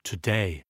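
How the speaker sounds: "Today" with a light first syllable and strong second; second-syllable stress. Shown as a demonstration. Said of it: "Accent sur la deuxième syllabe".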